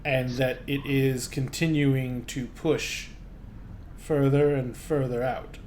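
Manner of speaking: a scholar explaining bad news gravely; grave gnome scholar. Gnome scholar explaining orbit decay to the visitors, soft and grave.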